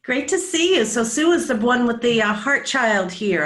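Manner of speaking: high, loud, excited welcome; delighted welcome. Real, not acted. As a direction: welcome a surprise guest with real delight. Delighted greeting of unexpected caller.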